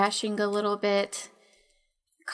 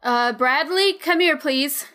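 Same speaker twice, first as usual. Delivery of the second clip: yell; 4 semitones higher, 6 dB louder than usual. Acted. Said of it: "yell out".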